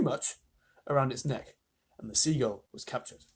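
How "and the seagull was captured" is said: ordinary delivery, nothing marked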